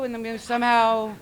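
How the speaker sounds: usual speaking voice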